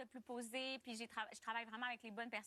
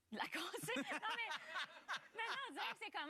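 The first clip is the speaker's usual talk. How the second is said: laughing; 7 semitones higher, faster than usual. Real, not acted. Laughing at her own joke.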